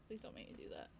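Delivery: breathless whisper, begging not to be made to; a scared little whispered plea. Retold or imitated. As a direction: beg them softly under your breath. Pleading with the boss, retold.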